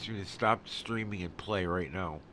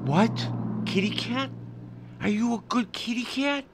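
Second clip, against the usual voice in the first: loud, delighted cat-greeting voice; cooing to a cat; 11 semitones higher, 4 dB louder than usual. Real, not acted. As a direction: coo questions at your kitty, pitched way up. Talking to an arriving cat.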